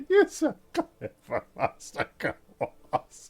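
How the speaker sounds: high, slow, tickled gushing; giggling Alicia. Acted. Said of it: "and then she goes".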